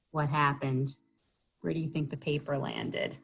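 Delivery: usual speaking voice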